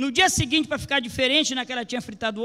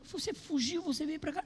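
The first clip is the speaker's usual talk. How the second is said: crying mother; 5 semitones higher, 10 dB quieter than usual. Retold or imitated. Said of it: "E ela começou".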